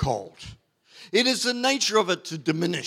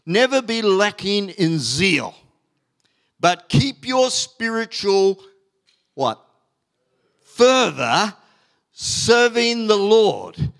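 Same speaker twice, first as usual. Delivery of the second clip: slow, louder, weighty reading of the verse; emphatic scripture reading; 4 semitones higher, 5 dB louder, slower than usual. Acted. Pastor to congregation, slow emphatic scripture reading. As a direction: lean on every word as if from the pulpit.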